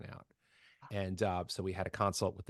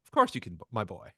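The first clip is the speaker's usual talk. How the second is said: booming Mid-Atlantic chorus master; Mid-Atlantic accent; 6 dB louder than usual. Retold or imitated. Chorus teacher encouraging a reluctant boy, Mid-Atlantic accent. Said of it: "the Mid-Atlantic thing".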